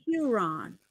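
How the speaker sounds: 'Huron' copied with a tentative American accent; attempted American pronunciation. Shown as a demonstration. [name] copying her co-host's model, attempted American pronunciation. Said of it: "have an American accent for it to work".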